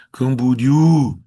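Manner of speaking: Camboriú slow, with a loud long Ú; Camboriú drawn out, landing hard on the last vowel; stress on final Ú. Shown as a demonstration. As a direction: hold and push the Ú in "Camboriú". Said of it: "regra do hiato no U".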